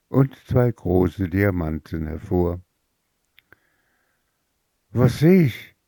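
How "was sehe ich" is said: higher, amazed question; astonished cry. Acted. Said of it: "rief die Mutter erstaunt".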